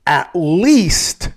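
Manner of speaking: "at least" drawn out slowly with weight on least; stress on "at least". Shown as a demonstration. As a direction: lean hard on "at least", stretching it out. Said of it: "And I emphasize the word".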